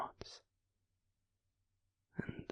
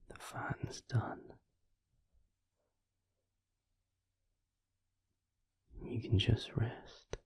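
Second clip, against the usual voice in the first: soothing whisper; 6 semitones higher, 7 dB quieter than usual. Acted. Comforting the listener to rest.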